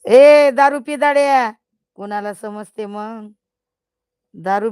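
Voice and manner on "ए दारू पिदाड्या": loud, high mock-angry yell; a playful full-voice shout at the drinker; teasing yell. Real, not acted. Yelling at the drinker viewer.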